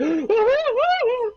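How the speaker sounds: high, loud gasping shriek between laughs; hammed-up horrified gasp; mock gasping scream. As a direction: gasp like a terrified kid. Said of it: "he was like".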